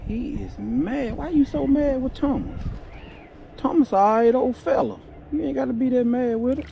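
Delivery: high mock-scolding sing-song; teasing the fish. Angler teasing an angry crappie on the line, high playful scolding. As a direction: tease him like a sulky kid.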